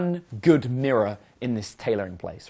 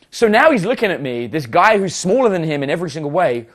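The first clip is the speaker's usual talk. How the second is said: usual speaking voice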